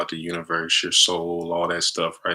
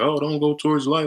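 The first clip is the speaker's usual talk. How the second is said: mock warning; 8 semitones higher, 4 dB louder than usual. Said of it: "Don't listen to nobody say".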